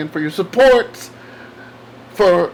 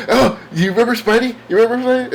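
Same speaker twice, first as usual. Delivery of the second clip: put-on higher-pitched, eager child's question; imitating little girl; 6 semitones higher, faster than usual. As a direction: lightly put on a little girl's voice. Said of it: "she goes".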